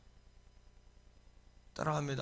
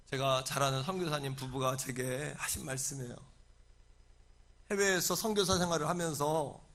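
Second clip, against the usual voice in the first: usual speaking voice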